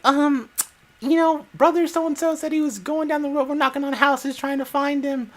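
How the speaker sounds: high mock church-lady voice; imitating aunt. Aunt at the door asking for brother, high mock voice. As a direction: put on the aunt's high polite voice. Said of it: "She's like".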